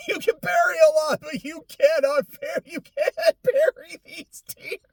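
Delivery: high-pitched, laughing mock sob; mock crying. Gamer joking about the feels hitting hard, laughing mock cry. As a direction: wail it, half laughing, half crying. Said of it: "probably going to start crying at some point".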